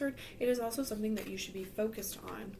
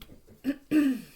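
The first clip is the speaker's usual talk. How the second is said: loud rough throat clear; 4 semitones higher, 11 dB louder than usual. Real, not acted.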